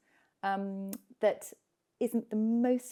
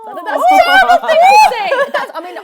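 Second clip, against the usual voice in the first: high laughing self-rebuke; 19 dB louder than usual. Real, not acted. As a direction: laugh through the words. Laughs at own remark.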